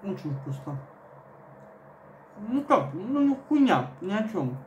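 chewing between words; talking while chewing. Real, not acted. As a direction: mouth partly full of bread. Seen 1: Bites roll at -2, then chews and talks while holding it.

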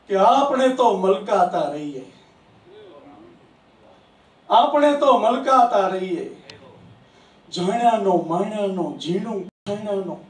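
poetry recitation. Acted. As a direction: declaim each line to the hall, savouring it.

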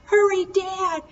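very high crying call; boy crying for his father; raw scared cry. A narrator's reading. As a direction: cry out in panic. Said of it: "raw and scared".

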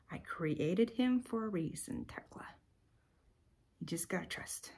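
hushed, near-whispered gentle answer; God's inner voice. Retold or imitated. God answering a worn-out mother in her heart, hushed. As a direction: speak softly, as if inside someone's heart. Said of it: "I heard God speak in my heart".

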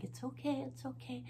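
calm the frightened duck softly; hushed, gentle reassurance; peaceful soothing. Retold or imitated. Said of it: "I thought real peaceful thoughts".

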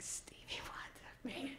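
hushed, awed whisper of the name; starstruck whisper. Retold or imitated. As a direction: whisper it so he won't hear. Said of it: "I'm like".

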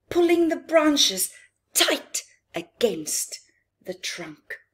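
read-aloud voice; dramatic storybook reading. A narrator's reading. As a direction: tell the trap like a tense bedtime tale. Reads picture book to children.

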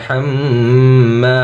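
هاممা said with a light, thin ha and a held doubled mim, slowly. Shown as a demonstration. Quran teacher showing students the light ha, slow demo. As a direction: keep the ha thin in হাম্মা. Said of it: "হা পাতলা হবে".

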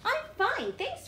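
high, chirpy pigeon reply. Acted. Teacher voicing the pigeon, sweetly answering the reader. Pigeon's lines read aloud.

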